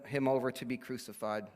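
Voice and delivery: ordinary talk, nothing marked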